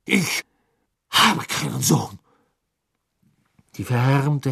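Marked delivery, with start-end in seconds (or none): 0.1-2.2 kalt verstoßend. A narrator's reading. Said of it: "bellte Crouch".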